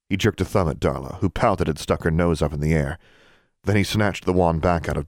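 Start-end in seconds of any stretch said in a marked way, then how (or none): none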